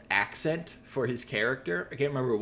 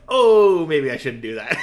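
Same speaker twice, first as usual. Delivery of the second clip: sudden realization; 2 semitones higher, 10 dB louder than usual. Retold or imitated. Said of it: "it clicked in Burt Reynolds' head".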